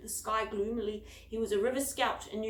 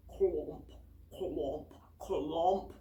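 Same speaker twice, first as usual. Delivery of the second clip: low, heavy clomp sounds; clomping footsteps; 5 semitones lower, much slower than usual. A narrator's reading. Narrator voices footsteps coming down the tunnel, low and heavy. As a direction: thud out each footstep.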